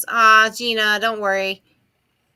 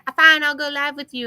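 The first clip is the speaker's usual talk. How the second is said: higher, louder imitation of a reluctant yes; mock giving in; 6 semitones higher, 5 dB louder than usual. Host quoting her friend agreeing to go live, exaggerated giving in. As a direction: act out a friend caving with a big "fine".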